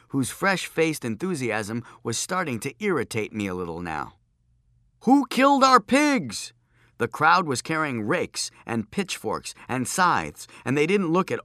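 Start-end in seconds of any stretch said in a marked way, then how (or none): 5.0-6.5 high, slow angry shout; angry villager. A narrator's reading. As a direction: yell like a furious villager. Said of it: "angry crowd voice".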